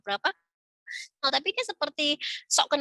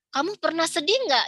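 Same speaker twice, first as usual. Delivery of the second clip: gently asking parent; 6 semitones higher, 3 dB louder than usual. Retold or imitated. Ini ditanya sama fasilnya.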